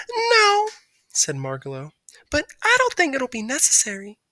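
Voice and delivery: a high, dainty voice; sweetly declining; polite bird. A narrator's reading. Margalo telling Stuart a temperature check isn't needed.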